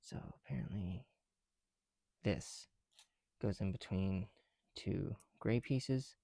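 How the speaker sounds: ordinary delivery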